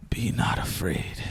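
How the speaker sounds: slow, whispered, hoarse otherworldly voice; a slow rasping voice from something not human; hoarse deep voice. A narrator's reading. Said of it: "she said in a hoarse, deep voice".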